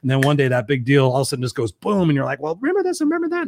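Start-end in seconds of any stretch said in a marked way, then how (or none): 2.4-3.5 high, rapid mimicking voice; mock grievance. Partner suddenly dredging up old grievances, mock nagging. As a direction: rattle off old grievances in a squeaky put-on pitch. Acting out a pent-up grievance outburst.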